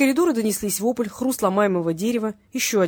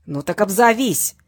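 loud, slow, angry challenge; forceful, drawn-out snarl; barked demand; 7 dB louder, slower than usual. A narrator's reading. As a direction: bark it out, stretching the words. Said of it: "рявкнула трубка".

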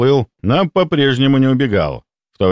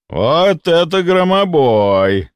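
slow, higher, awed exclamation; admiring; 7 semitones higher, much slower than usual. A narrator's reading. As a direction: marvel at the revolver. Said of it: "восхищённо произнёс он".

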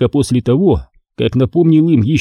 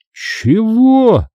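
a long, drawn-out surprised "Чего?", pitched higher; surprised drawl; 10 semitones higher than usual. A narrator's reading. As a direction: stretch the word out in disbelief. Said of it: "удивлённо протянул Фёдор". (удивлённо протянуть, выше голосом)

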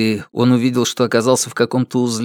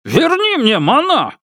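higher, raging demand; furious shout; 14 semitones higher than usual. Acted. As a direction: yell it in rage. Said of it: "взревел Ма Дунчень".

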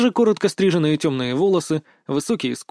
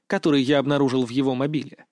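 ordinary delivery, nothing marked

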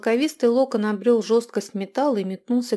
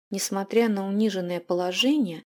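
plain reading voice

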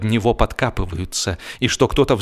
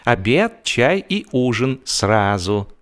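George savouring the menu; 7 semitones higher than usual. A narrator's reading. George relishes the feast menu.

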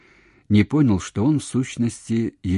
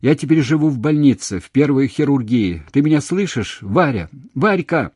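loud, raised, insistent; urgent shout; 6 semitones higher, 6 dB louder than usual. A narrator's reading. Man giving her the hospital ward, urgent shout. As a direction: call out urgently down a bad line. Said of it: "крикнул он".

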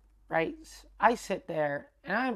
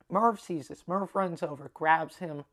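plain reading voice

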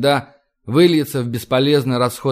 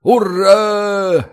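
loud, high unified roar; roaring cheer; 6 semitones higher, 4 dB louder than usual. A narrator's reading. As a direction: roar it as one line. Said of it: "дружно ревёт строй".

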